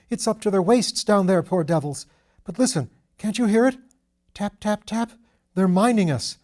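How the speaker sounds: high, loud, desperate pleading; frantic with battlefield terror; terrified panic. Acted. Shell-shocked officer hears mining.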